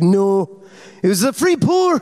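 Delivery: high insistent Scottish woman; Scottish lilt, very high, stubborn; imitating Ruth's Scottish insisting; Scottish accent. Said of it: "She's like".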